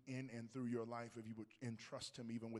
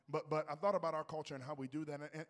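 usual speaking voice

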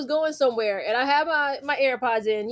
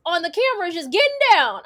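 higher, bubbly office coworker teasing; 7 semitones higher, 3 dB louder than usual. Retold or imitated. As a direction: imitate the coworker catching her dancing. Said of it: "She was like".

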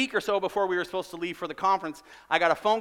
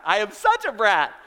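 louder, higher comic self-reproach; exclaimed confession; 6 semitones higher, 7 dB louder than usual. Real, not acted. Pastor owning up to congregation, loud comic exasperation. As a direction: belt it out in mock exasperation at yourself.

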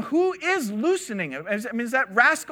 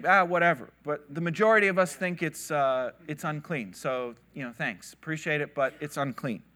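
dismissive; 6 semitones lower, 5 dB quieter than usual. Retold or imitated. Sages dismiss Rabbi Eliezer.

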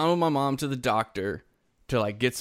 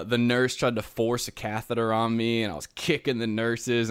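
ordinary talk, nothing marked

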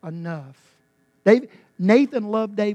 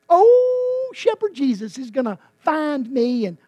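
high, loud, sugary mock-sweet voice; mocking fluffy piety; 7 semitones higher, 9 dB louder than usual. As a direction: parody a naive sentimental believer. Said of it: "we make that sort of fluffy".

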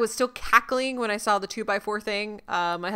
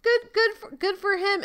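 squeaky proud echo; sing-song cheer for him; high-pitched praise; 10 semitones higher than usual. Real, not acted.

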